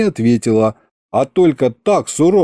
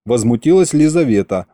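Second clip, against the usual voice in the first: ordinary delivery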